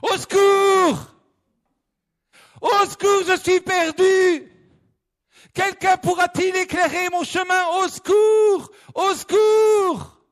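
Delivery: high, loud desperate yells for help; panicked cries for help. Acted. A lost hiker shouting into the night for help. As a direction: scream for help, lost in the dark forest. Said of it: "il a commencé à crier".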